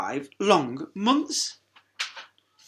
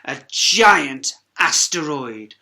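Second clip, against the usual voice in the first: usual speaking voice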